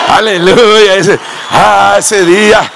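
loud fervent preaching, slow on the plea; preaching at full force. Acted. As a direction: cry out the rescue to the congregation. Preaching builds again. (predicación fervorosa y fuerte)

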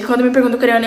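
ordinary talk, nothing marked

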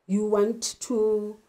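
ordinary talk

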